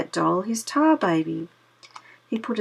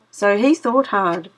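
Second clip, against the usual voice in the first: ordinary delivery, nothing marked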